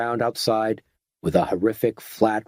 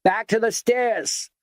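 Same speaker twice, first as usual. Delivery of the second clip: much higher, slower bellowing command; bellowed; 11 semitones higher than usual. A narrator's reading. Garnish repeats the order to the stairs, drawn-out bellow. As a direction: drag out the order so the whole lobby hears. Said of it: "bellowed Kenneth Garnish".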